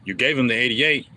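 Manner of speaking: ordinary delivery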